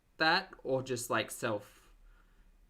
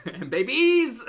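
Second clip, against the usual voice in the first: loud, higher, slow, through laughter; laughing; 11 semitones higher, 12 dB louder, slower than usual. Real, not acted. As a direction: burst out laughing. Bursts laughing at clip.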